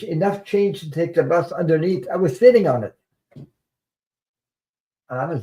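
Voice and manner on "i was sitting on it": ordinary delivery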